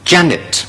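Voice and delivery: Janet with stress on Ja; teacher models Janet; correct pronunciation. Shown as a demonstration. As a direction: model Janet, first syllable strong. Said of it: "第一個係".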